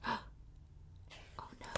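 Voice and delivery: scared unvoiced whisper. Reader fearing for the girl, whisper. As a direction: gasp it under breath. Narrator fearful aside.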